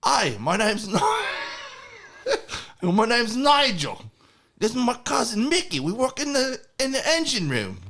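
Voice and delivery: high, put-on British voice; fake British accent. Drunk passenger fooling the security guard, in a fake accent. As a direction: bluff through in a made-up accent. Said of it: "what accent is this".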